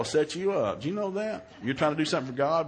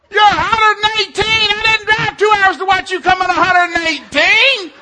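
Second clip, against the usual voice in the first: very high, hammed-up yell; outraged shouting; 15 dB louder than usual. Dad berating his daughter for placing badly, outraged. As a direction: rant at the top of your voice.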